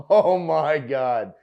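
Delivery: ordinary delivery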